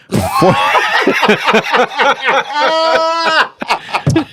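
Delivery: shrieking laugh; loud burst of laughter. Real, not acted. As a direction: crack up loudly.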